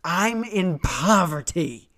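loud, slow, high-pitched wail; hammed-up cry of hardship; mock desperate plea. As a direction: plead it out, stretching every word. Mock pleading over the pickle.

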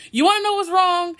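put-on teen yelling at her mom who keeps asking; retold outburst. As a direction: throw the question back at her, fed up. Snapping back at worried mom.

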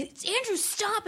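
high-pitched little-sister protest; retold squeal to stop; mock protest. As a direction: plead high without raising the volume. Acts out protest at pinching brother.

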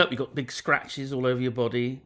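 ordinary talk, nothing marked

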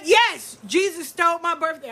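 put-on high, firm little girl; insistent child. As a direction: insist like a stubborn kid. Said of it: "She said".

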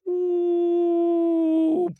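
high, loud falling "ooh"; falling whistle. A narrator's reading. Eagle drops the big fish, high falling ooh. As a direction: sound the drop through the air.

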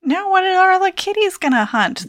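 high-pitched worried squeak; high squeaky voice. Pretend. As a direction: ask it in a tiny high voice. Squeaky worried question about kitties.

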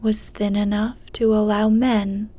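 ordinary delivery

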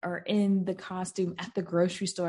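ordinary talk

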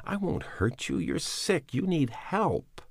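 slow, soft coaxing plea; gentle pleading. A narrator's reading. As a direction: soothe the sick dog under the bed. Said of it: "he pleaded unhappily".

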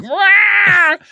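very high silly monster "Rah!"; hammed-up high monster cry; creature roar. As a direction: yelp it like a goofy creature. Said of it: "making the sound of".

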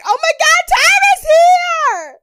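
squealing excitement. Retold or imitated. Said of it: "the girls are like".

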